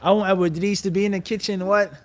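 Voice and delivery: ordinary talk, nothing marked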